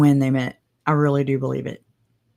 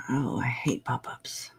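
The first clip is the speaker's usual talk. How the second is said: whispering; 4 semitones lower, 10 dB quieter than usual. Real, not acted. Annoyed aside.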